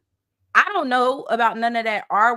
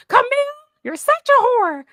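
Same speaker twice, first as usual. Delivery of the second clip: Dorit; 8 dB louder than usual. Acted. Co-host imagining the housewife insulting Camille, hammed-up impression. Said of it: "You want her to be like".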